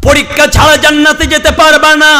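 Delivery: high, emphatic proclamation; full-force preaching. Real, not acted. Preacher voicing God warning His servant of the test, high emphatic preaching.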